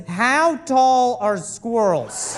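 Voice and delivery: slow, deliberate reading of the query; read-out search query. Retold or imitated. Husband reading wife's Google search aloud, slowly. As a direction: spell out the absurd search. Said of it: "a Google search that says".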